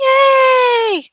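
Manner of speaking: high, loud squealing laugh. Real, not acted. Laughing at imagined fans, a high squealing laugh. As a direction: burst into a shrill giggle. Laughs after mock cheer.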